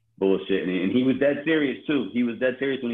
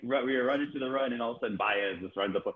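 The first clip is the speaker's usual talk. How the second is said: ordinary talk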